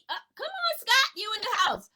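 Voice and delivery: high, loud hype shout-out; calling out. Real, not acted. Greeting the live chat with a loud shout-out. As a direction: call the name out big and bright.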